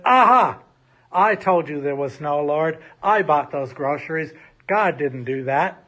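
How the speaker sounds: loud, triumphant gotcha; spring out and gloat. Acted. Neighbor jumping from the bush to mock her, loud gloating. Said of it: "jumped from behind a bush and said".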